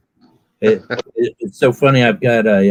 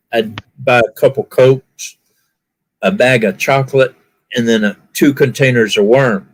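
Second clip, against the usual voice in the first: ordinary delivery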